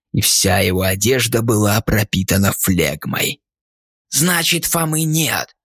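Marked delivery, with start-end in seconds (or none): 4.1-5.6 lightly voiced rotting man; higher, sneering ghoul; vile guest voice. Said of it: "проговорил скверный гость".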